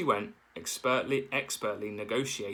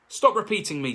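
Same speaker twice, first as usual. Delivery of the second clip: louder, higher annoyed snap; angry yell; 10 semitones higher, 8 dB louder than usual. A narrator's reading. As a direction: snap at her brother. Sister bear yells.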